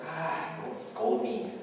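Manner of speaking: slow pleading. Acted. Pleading with friar.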